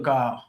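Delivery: "goal" coming out with a Scottish-sounding vowel; "goal" mispronounced by accident; slipped vowel in "goal". Real, not acted. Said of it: "I'm, I'm Scottish".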